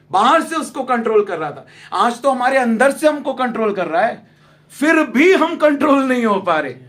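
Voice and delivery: loud, high, forceful preaching; fiery preaching. Acted. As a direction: drive the point home to the congregation.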